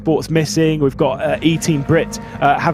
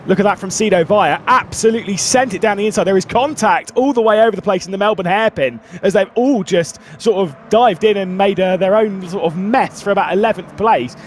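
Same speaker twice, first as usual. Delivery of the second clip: lively live race call; motorsport commentator; 6 semitones higher than usual. Acted. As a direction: call the chaos in the pack.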